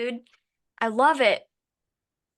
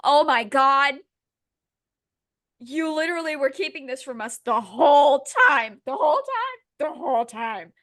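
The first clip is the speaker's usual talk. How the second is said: mock outrage; 3 semitones higher, 5 dB louder than usual. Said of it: "and it's like".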